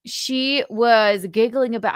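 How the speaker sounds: usual speaking voice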